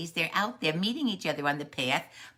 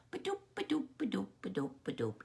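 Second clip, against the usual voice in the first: light, soft imitation of hopping; frog hopping sound; 2 semitones higher, 5 dB quieter, much faster than usual. Storyteller hops a frog down the path, hopping sound. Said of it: "Frog is out hopping down the path".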